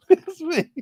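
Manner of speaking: squeaky high pleading grandchild. Retold or imitated.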